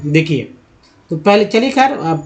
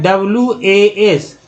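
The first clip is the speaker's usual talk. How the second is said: slow letter spelling; much slower than usual. Shown as a demonstration. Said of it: "इसे बोलते हैं वाज".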